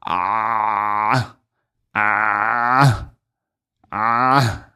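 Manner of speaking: ah squeezed hard from the contracted larynx; over-done pharyngeal ah; exaggerated throaty ah. Shown as a demonstration. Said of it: "I have to exaggerate in the beginning".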